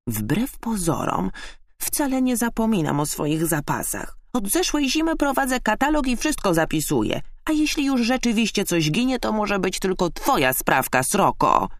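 high-pitched indignant squirrel retort; accusing. A narrator's reading. Squirrel blaming the magpie, accusing high voice. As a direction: build from measured denial to high accusation. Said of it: "rzuciła oskarżycielskim tonem".

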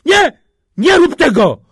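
high, loud pleading shout; desperate high-pitched yell; panicked cry. A narrator's reading. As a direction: cry out to be spared. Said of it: "zawołał w skrzyni Kościelny".

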